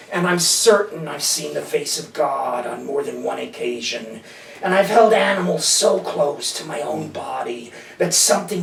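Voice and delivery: slow, measured poem recital; slow verse reading, settling at each phrase end; poetry reading. Acted. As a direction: recite your poem unhurried, drawing out the line ends. Reads own poem aloud.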